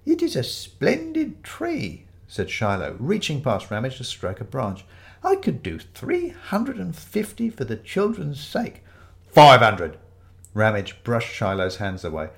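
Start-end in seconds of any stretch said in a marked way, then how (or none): 0.1-2.1 very high, slow admiration; quavery elderly vicar. Acted. Fake vicar strokes a branch, high, slow and quavery. 5.2-8.8 high, slow, wavering offer; quavery elderly vicar. Acted. Fake vicar bids for the children's sake, high and quavery. 9.3-10.0 very loud, forceful bid; angry shout. Acted. Ramage outbids the vicar, shouting angrily.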